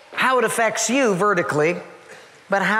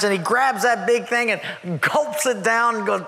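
ordinary delivery

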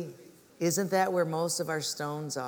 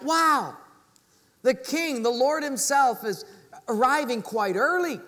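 high, louder awed thought; excited wonder; 5 semitones higher, 3 dB louder than usual. Retold or imitated. Cobbler hears a knock expecting the Lord, excited. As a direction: imitate a man thrilled at his guest's arrival. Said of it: "was so excited".

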